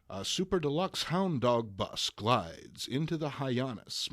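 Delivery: plain reading voice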